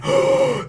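gasp. Retold or imitated. Crowd gasps at cape.

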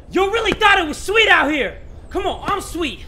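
high, loud trash talk; taunting shout. Real, not acted. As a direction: crow it at a beaten opponent. Taunting the rock, raised voice.

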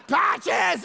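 loud yell, falling at the end; repeated shout for Patches; calling again. Retold or imitated.

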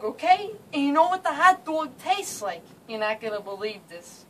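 brash New Jersey accent; thick New York and Jersey street accent. Shown as a demonstration. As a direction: talk like a guy from New Jersey. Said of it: "I'm going to demonstrate his accent".

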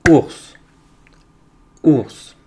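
Ours as one loud, isolated beat; loud, short Ours set apart by silence; syllable beat. Shown as a demonstration. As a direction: punch Ours as a single syllable. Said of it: "Je les fais devant toi".

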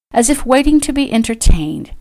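usual speaking voice

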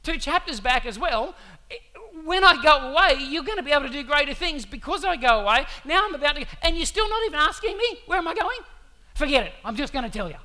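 rising exasperation at the disciples who won't ask; frustrated Jesus. Retold or imitated. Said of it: "I can sense the frustration in him going".

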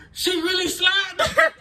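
high, loud shocked exclaim; stunned exclaim. Retold or imitated. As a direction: cry out in disbelief. Shocked realization at the handprint.

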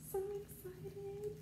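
slow, soft, high so excited; hushed excited. Real, not acted. Opening a package for viewers, hushed slow excitement. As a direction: breathe it out softly and slow.

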